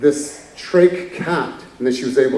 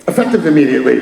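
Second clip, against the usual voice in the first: laughing repeat; 6 dB louder, much faster than usual. Retold or imitated. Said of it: "He's like".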